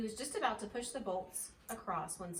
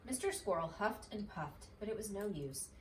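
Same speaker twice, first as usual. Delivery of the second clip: ordinary delivery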